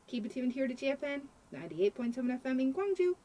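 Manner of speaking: upbeat station promo read; radio announcer. Acted.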